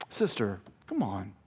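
soft, concerned coaxing; gentle pleading. Acted. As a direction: plead quietly, as one who cares. Gentle appeal to straying sister.

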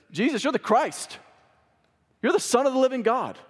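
bold, high-pitched declaration; Peter. Retold or imitated. Bold disciple answering Jesus before the others, high emphatic declaration. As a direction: step up and proclaim it, voice jumping high. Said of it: "the bold, he steps up".

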